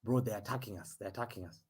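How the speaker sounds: nervous hushed warning; tense hush. Real, not acted. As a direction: say it under your breath. Tense moment, Leeds attack.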